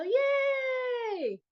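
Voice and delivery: high, drawn-out celebratory yay; congratulatory cheer. Real, not acted. Host celebrating viewers on live and replay, congratulatory cheer. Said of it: "that is my congratulatory thing".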